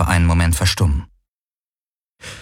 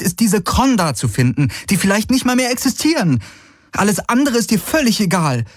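put-on higher young woman's voice, hurt and reproachful; Kirai pleading; 12 semitones higher than usual. Kirai confronting Galen about his obsession, hurt and pleading. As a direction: plead with him, rushing the words.

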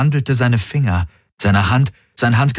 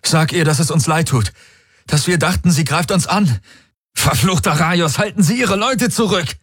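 high-pitched, agitated plea building to a command; plead, then order them back urgently; urgent appeal; 9 semitones higher than usual. A narrator's reading. Desperate plea and order amid standoff. (aufgeregt flehend, dann befehlend)

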